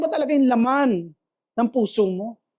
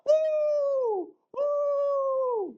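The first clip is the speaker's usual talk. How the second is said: falsetto woo, much higher; high party whoops; 6 dB quieter than usual. Retold or imitated. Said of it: "Pag nasa party ka". (matinis na sigaw sa party)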